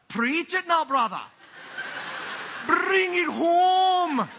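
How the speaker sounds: high, loud Scottish shout, the last words drawn out slowly; Scottish congregation yelling back. Retold or imitated. Congregant yells at the preacher in a Scottish brogue, shouting. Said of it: "where the people yell back".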